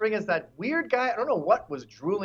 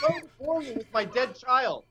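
higher, bored mock complaint; imitated groan of impatience; 6 semitones higher than usual. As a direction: whine it like a callous heckler. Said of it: "Did you hear them groan?".

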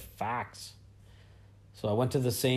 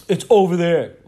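much louder, dismissive mock voice; mocking rude server; 5 semitones higher, 11 dB louder than usual. Mocking the pizza-counter lady's dismissive brush-off. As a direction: imitate her brushing him off. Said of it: "And she's like".

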